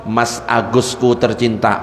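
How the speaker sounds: low, slow mock-loving endearment; mock romantic call. Wife teasing her balding husband, low slow croon. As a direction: drawl it lovingly to tease.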